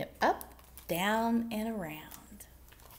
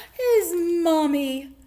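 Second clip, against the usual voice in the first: loud, high, drawn-out delight; slow, gushing storybook climax; joyful reveal; 6 semitones higher, 9 dB louder, slower than usual. A narrator's reading. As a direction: stretch it out as the big happy reveal.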